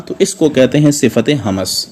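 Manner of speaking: ordinary delivery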